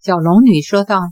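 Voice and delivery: ordinary delivery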